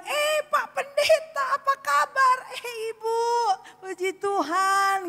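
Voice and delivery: very high, drawn-out hello; exaggerated high-pitched welcome; effusive greeting. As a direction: greet with big, slow, sing-out delight. Acted effusive greeting between acquaintances.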